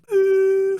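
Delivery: very high, loud beep; hammed-up machine beep; mock heart monitor beep. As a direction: imitate the flatline tone, squeaky and sharp. Flatlining heart monitor joke.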